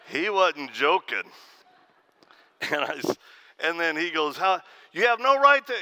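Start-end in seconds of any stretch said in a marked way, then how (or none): 4.4-5.7 high, loud mock protest; getting louder, indignant. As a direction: imitate an outraged man working himself up. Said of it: "he starts getting louder".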